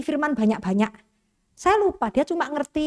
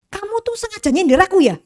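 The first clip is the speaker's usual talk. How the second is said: sharp, higher and louder, accusing the pastor; angry accusation; 8 semitones higher, 6 dB louder than usual. Retold or imitated. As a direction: confront him as if insulted. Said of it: "Dia bilang gini". (menuduh dengan marah, suara lebih tinggi dan keras)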